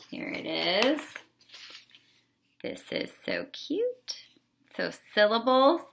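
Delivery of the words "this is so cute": high breathy whisper, much higher and softer; delighted aside. Real, not acted. Teacher gushing quietly over a cute spring worksheet. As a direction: gush over how cute it is under your breath.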